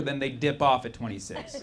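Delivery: normal talking voice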